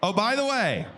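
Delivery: ordinary talk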